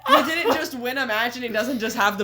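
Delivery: real laughing. Real, not acted. Host questioning the wrestler's odd thanks, laughing hard. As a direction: keep explaining while cracking up.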